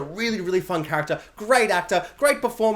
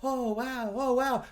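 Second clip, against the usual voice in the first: small Harry awe; 4 semitones higher, 8 dB quieter, much slower than usual. Retold or imitated. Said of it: "where Harry's like".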